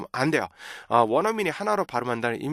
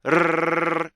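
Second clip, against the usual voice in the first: rolled R held on one note; sustained tongue trill; 3 semitones higher than usual. Shown as a demonstration. Teacher showing an exercise, long tongue trill. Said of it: "이거 있죠".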